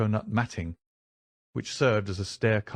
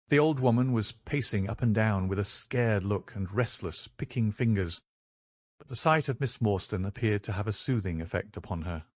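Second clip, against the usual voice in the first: usual speaking voice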